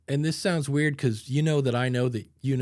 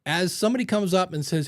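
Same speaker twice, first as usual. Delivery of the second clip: normal talking voice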